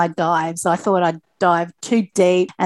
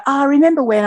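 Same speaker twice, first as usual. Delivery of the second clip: lightly mocking her own reminiscing, pitched up; a higher, self-teasing "back in my day"; 7 semitones higher, 4 dB louder, faster than usual. Athlete imitates herself talking to kids, playfully reminiscing.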